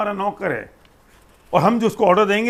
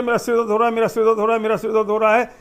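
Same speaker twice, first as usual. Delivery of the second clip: insistent complaint, a little raised, repeated like a chant; saying it out loud; 3 semitones higher, faster than usual. Retold or imitated. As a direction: keep telling yourself your head hurts. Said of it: "हमने जोर से बोलना शुरू किया". (ज़ोर से दोहराई गई शिकायत)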